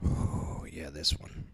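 whispered aside, unvoiced; quick whispered aside. Real, not acted. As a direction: breathe it to yourself. Aside to himself.

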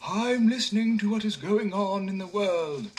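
a strange calm stranger; listening man. A narrator's reading. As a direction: listener answering the simpleton. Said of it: "replied the man".

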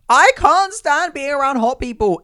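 high, loud comic complaint; exasperated outburst. Acted. Model overwhelmed by hot people backstage, exasperated. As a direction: cry it out in mock despair. Mock meltdown continues.

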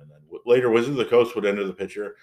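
normal talking voice

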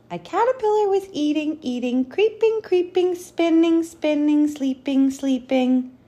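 high, bright read-aloud singsong; storytelling voice. Acted. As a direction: read it like a picture book.

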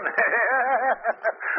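unhinged cackle; mad laugh. Acted. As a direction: laugh like a madman. Madman gloating over his victim.

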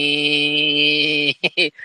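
long drawn-out electric seat whirr; imitated seat motor. Slow car seat moving back, imitated motor whine. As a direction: hold a steady motor whine.

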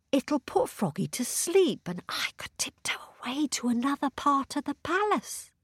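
sneaky whispered plan. Acted. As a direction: whisper it like a secret plot. Princess's sneaky thought.

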